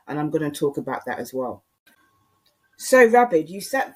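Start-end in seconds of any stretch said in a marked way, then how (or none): 2.8-4.0 sudden loud, sharp address by name; pointed call-out. Real, not acted. Vlogger loudly calls out a viewer, pointed.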